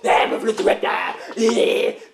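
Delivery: slow, voiceless raptor rasp. Pretend. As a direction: breathe it out slowly like a beast. Raptor on the table.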